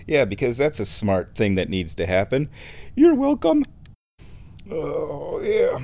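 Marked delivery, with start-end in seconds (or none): 3.0-5.8 high smug mock bow. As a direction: say it with smug pride. Smug joke after remark.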